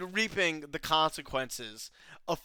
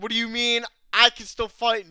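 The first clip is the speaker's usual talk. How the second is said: loud, higher mock protest; indignant retort; 7 semitones higher, 8 dB louder than usual. Badger refusing to leave the fight, indignant. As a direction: protest in disbelief, voice pushed up. Voicing defiant Badger, scene retold.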